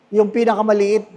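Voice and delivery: ordinary talk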